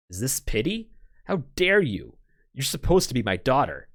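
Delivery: an insulted father lashing out at his daughter, furious; sudden outrage. Acted. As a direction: erupt at her, offended.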